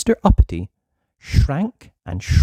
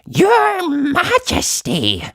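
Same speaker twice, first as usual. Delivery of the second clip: very high, slow squeaky goblin voice; goblin herald; 3 dB louder, much slower than usual. Acted. Goblin announcing to the King, high and squeaky.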